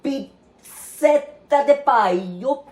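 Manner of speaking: slow, hammed-up Italian accent. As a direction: drawl it like a fake Italian chef. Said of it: "as we Italians call it".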